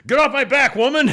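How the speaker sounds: high, loud mock fury; acted angry outburst. Husband snapping at wife in comic retelling, angry shout. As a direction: explode at a nagging wife.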